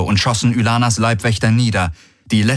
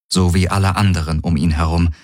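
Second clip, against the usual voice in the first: usual speaking voice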